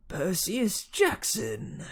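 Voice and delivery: high, drawn-out monster teacher; menacing villain. A narrator's reading. Fury teacher confronting the hero, menacing villain. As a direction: draw the name out, high and slow. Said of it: "in an accent that was definitely".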